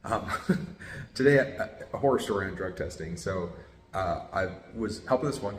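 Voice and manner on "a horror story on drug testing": normal talking voice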